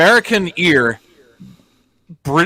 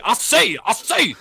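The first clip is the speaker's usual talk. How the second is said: higher cartoon rooster bluster; Foghorn Leghorn impression; 7 semitones higher than usual. Cohost asking about Southern speech, doing a higher cartoon rooster voice. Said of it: "is that Foghorn Leghorn".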